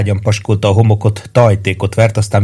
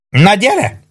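shouted taunt; 11 semitones higher than usual. A narrator's reading. Maggio dares the military policeman, shouted high. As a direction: call out a high challenge. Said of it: "kiáltotta Maggio".

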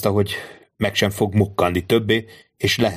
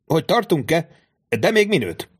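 büszke Peppino; 8 semitones higher than usual. Acted. Peppino proudly answers about kitchen.

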